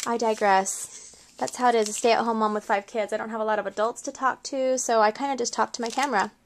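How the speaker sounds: ordinary talk, nothing marked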